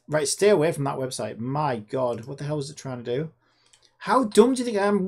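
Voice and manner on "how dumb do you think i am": mock outrage. Real, not acted. Mocking the scam site again.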